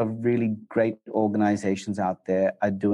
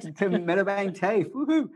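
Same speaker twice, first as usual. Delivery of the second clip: playful high cheer with a whoop; 9 semitones higher than usual. Real, not acted. Echoing a cheerful shout out.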